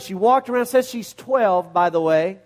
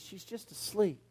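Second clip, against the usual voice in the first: nearly whispered, much softer; hushed; 16 dB quieter than usual. Acted. Jesus telling the mourners she sleeps, hushed and soft. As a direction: let it fall to a hush.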